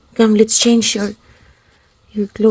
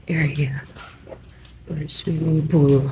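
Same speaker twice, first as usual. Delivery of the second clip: whispered word; 4 semitones lower, 8 dB quieter than usual. Real, not acted. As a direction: whisper so nobody hears. Quiet in hotel corridor.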